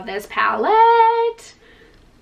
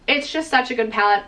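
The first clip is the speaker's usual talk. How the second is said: usual speaking voice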